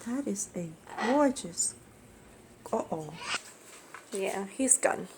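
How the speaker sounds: hushed, so as not to scare the bird; wildlife watching whisper. Real, not acted. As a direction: keep it soft while filming. Filming a bird up close.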